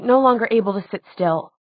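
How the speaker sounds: ordinary delivery, nothing marked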